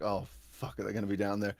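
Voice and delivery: low, hushed retold dread; agitated worry. Agitated moviegoer fearing they wait downstairs, low worry. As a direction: drop low and soft, worrying aloud. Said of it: "I'm still kind of agitated".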